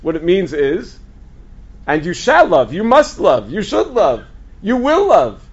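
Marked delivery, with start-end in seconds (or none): 1.9-5.4 loud insistent command, building higher; emphatic command. Shown as a demonstration. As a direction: declaim each phrase as an order, rising in force. Declaims the verse as a command.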